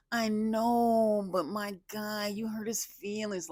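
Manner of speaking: high, whiny put-on voice; imitating Edmund. Edmund whining to Annie about Brendan, high whiny voice. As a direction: whine it like a sulky guy. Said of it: "he talks like".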